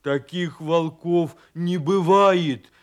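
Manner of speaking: loud, slow, emphatic shout; outraged bear cub yell. Acted. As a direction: shout it, stretching the last word. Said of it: "крикнул медвежонок".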